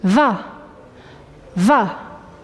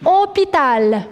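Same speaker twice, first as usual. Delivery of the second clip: hôpital drawn out slowly; slow dictation; slower than usual. Shown as a demonstration. Teacher dictating hôpital to pupils, slow dictation. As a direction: stretch hôpital out for pupils writing it.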